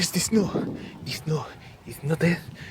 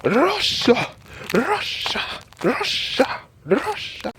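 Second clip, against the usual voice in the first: mock Russian shout; 10 semitones higher, slower than usual. Bragging he can do Russian, hammed-up Russian shout. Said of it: "but I can do Russian".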